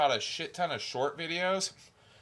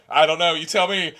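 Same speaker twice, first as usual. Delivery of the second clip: mock defiant reply; 8 dB louder than usual. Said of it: "I'm like".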